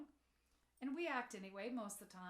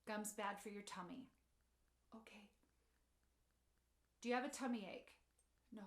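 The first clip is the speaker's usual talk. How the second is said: reassuring mother; 3 semitones lower, 4 dB quieter than usual. Retold or imitated. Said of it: "I just had to say".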